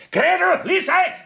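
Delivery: high, loud call for the maids. Acted. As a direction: shout for the maids in a panic. Ruft panisch nach den Mägden. (panisch rufend nach den Mägden)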